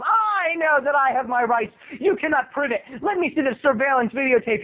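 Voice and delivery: high, self-righteous retort; hammed-up indignant boy; indignant kid. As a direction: declare it with mock righteous defiance. Retold kid demanding his rights.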